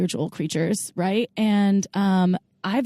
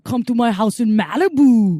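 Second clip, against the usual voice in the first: put-on louder, heavy Austrian accent like Schwarzenegger; Schwarzenegger impression; 6 dB louder than usual. Inviting listeners to Malibu as Schwarzenegger, in a heavy Austrian accent. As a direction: lay on a thick Austrian accent. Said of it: "That was pretty good".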